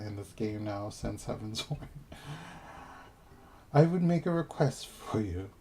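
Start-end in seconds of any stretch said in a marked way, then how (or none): 3.7-5.5 put-on higher, louder British accent for the Nath; hammed-up British quest voice, giggling into it. Gamer voicing the Nath in a higher, louder British accent.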